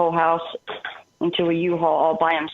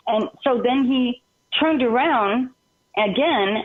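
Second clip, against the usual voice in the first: usual speaking voice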